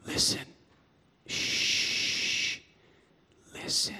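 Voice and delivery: barely audible soft hush; soft shushing listen. Retold or imitated. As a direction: hush them gently into silence. God hushing him.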